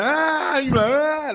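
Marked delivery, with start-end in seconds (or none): 0.0-0.6 high mock baby cry; mock baby wail. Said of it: "They be like". 0.9-1.3 high fake wail aimed back at the baby; mock crying back. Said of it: "you gotta act like they crying too".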